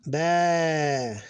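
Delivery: "Ba" with a long held vowel; extended vowel. Shown as a demonstration. Teacher reading Arabic syllables, "Ba" drawn out. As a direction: stretch out "Ba" evenly. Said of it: "the extending letter alif here".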